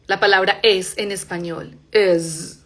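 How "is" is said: is, buzzing final Z. Shown as a demonstration. Teacher showing is sounds with Z. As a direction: end is on a voiced Z. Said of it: "suena con z".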